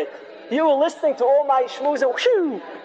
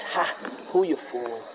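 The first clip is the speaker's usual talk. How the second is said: low, quiet taunt; inner accusing voice; 9 semitones lower, 8 dB quieter than usual. Acted. Sin's voice taunts the sinner, low and quiet. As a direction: sneer it low, under full voice. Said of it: "that voice pounds away at you".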